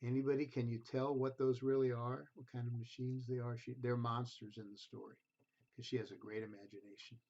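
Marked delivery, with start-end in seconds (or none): none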